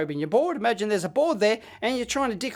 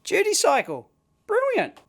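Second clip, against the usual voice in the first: high-pitched pleased exclamation; pleased surprise as it comes up; delighted; 10 semitones higher than usual. Real, not acted. Tester sees duty cycle appear, delighted.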